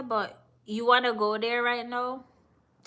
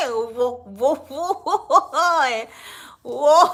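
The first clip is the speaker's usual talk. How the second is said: whoas bursting out through loud laughter; helpless laughing; 4 semitones higher, 7 dB louder, much slower than usual. Real, not acted. Cracking up at the accent.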